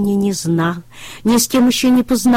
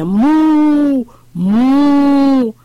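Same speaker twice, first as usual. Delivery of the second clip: higher, loud mooing; calf mooing; 7 semitones higher, 6 dB louder than usual. A narrator's reading. Бычок показывает, как бодаться, громко мыча. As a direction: moo like a butting calf. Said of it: "А вот так".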